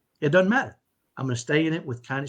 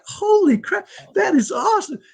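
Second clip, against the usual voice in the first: very high, loud awestruck delight; hammed-up overjoyed exclamation; retold amazement; 6 dB louder than usual. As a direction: squeal it out, thrilled and stunned.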